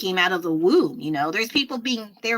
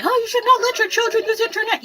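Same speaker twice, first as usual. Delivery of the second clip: much higher, louder mock-prissy voice; mocking scolds; 13 semitones higher, 4 dB louder, faster than usual. Mocking people who scold parents about internet, prissy high voice. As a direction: parody the finger-wagging critics. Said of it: "And people say".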